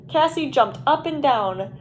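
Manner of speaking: plain reading voice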